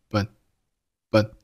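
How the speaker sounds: "but" with an unreleased t; swallowed final t. Shown as a demonstration. Teacher showing "but" with the t swallowed. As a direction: cut "but" off before the t sounds. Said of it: "但是把声音给吞掉".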